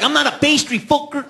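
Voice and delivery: imitating the boyfriend's offended reply; offended retort. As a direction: bristle at being called a pastry. Offended gangbanger snaps back.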